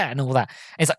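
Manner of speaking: ordinary talk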